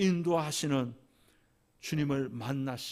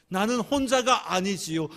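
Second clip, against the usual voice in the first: earnest, a little louder, mild tearful edge on 혼자가; tearful confession; 5 semitones higher, 5 dB louder than usual. Retold or imitated. Widow answering the Lord, mildly tearful. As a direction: confess it with rising feeling. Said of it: "하나님 앞에 울면서 고백합니다".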